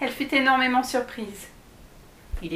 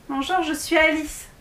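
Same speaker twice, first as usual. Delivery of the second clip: higher-pitched, mildly surprised greeting; astonished; 6 semitones higher than usual. A narrator's reading. Alice meeting a talking salamander, mildly astonished. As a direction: introduce yourself with a touch of astonishment. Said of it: "très étonnée par cette rencontre".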